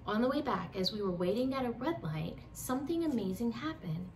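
ordinary delivery

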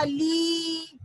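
ली with a long, held ee; long vowel. Shown as a demonstration. Teacher giving the pronunciation of ला with matra, long-held ली. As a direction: draw out the vowel of ली. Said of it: "उसका उच्चारण होगा".

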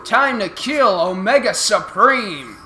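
slow, loud grandiose villain declaration; grand villain announcement. Acted. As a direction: proclaim yourself the almighty ruler. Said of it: "the almighty and powerful Megatron".

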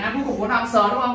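normal talking voice

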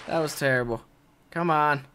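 normal talking voice

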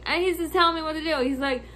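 ordinary delivery

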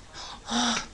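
sharp gasp of realisation; sudden gasp. Real, not acted. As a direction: gasp as an idea strikes. Realises July is coming.

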